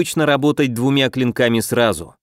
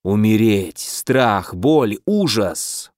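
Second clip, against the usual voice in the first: ominous inner voice; 5 semitones lower, much slower than usual. Acted. Mental attack repeated.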